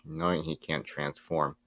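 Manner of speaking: usual speaking voice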